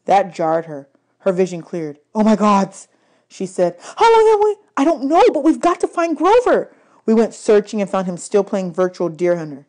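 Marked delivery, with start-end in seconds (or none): 2.1-6.7 high, panicky and loud; sudden alarm; alarmed girl voice. A narrator's reading. Annabeth snapping out.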